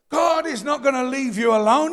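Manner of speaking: ordinary talk, nothing marked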